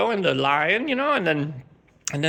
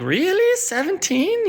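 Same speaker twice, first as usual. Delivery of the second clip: mock surprise; 14 semitones higher, slower than usual. To drunk neighbor Olga, mock disbelief. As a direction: act shocked by the number.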